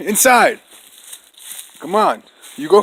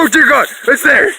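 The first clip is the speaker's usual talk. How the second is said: scared; 6 semitones higher, 4 dB louder than usual. Real, not acted. Frightened by bird in house.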